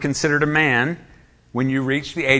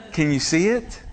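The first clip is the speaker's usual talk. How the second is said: usual speaking voice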